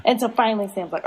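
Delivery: ordinary talk, nothing marked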